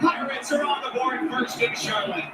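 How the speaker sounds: excited rising touchdown call; play-by-play call. Real, not acted. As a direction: call the big run live.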